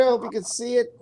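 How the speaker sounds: ordinary talk, nothing marked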